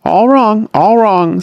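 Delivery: a mild reproving tut. A narrator's reading. Sam reproving the angry father, mildly chiding. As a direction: correct him with gentle reproof. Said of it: "with a reproving shake of the head".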